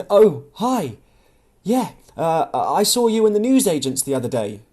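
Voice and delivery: a nervous, flustered hello. Acted. Louise meeting Tom again in the cafe, nervous and flustered. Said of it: "she said nervously".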